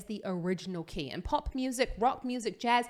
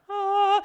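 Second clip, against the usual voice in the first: high, loud held "ah"; one loud, high vocal note; sung "ah"; 11 semitones higher, 9 dB louder than usual. Shown as a demonstration. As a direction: belt out a sustained high "ah". Demonstrating singing in a montage.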